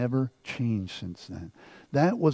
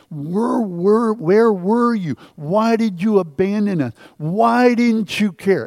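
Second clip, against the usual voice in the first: retold anguished cry, higher and louder; re-living a furious lament at God; railing at God; 7 semitones higher, 5 dB louder than usual. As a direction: hurl the questions at heaven. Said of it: "I railed at God".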